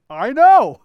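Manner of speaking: imitating a breezy salesman, high and loud; car salesman friend. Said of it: "And he goes".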